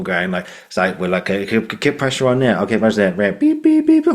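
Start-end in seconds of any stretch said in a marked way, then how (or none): 1.3-3.4 lightly acted medic, higher pitched; a hint of urgent emergency instructions; busy medical staff. Hospital staff working on injured child, lightly urgent. 3.4-4.1 very high, loud electronic beeping; imitated monitor beeps. Noisy hospital room around the parent, high mimicked beeps.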